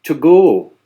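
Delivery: loud, high "To go" with a long pure O; Upper Midwestern O. Retold or imitated. Presenter imitates classmate saying "To go", long pure O. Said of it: "she would say the word to go".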